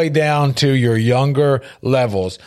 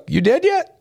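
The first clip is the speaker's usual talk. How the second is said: grandfather's teasing; 13 semitones higher than usual. Retold or imitated. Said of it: "And he'd be like".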